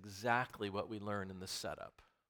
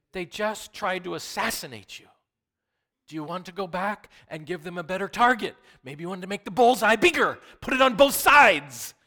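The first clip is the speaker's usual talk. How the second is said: rising to a high, loud sarcastic cry; mocking preaching; 7 semitones higher, 13 dB louder than usual. Pastor mocking a return to danger, loud sarcasm. Mocking the disciples' logic loudly.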